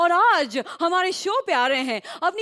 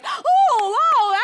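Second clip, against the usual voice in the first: excited oh; 6 semitones higher, 5 dB louder than usual. Acted. Judge reacts to the act.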